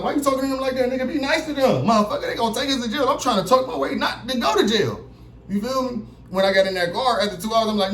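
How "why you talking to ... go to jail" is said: high, hurried plea to a friend; pitched-up panicky scolding; telling his friend to be nice to the cops, high and urgent; urgent pleading. Retold or imitated. Pleading with friend in cop car.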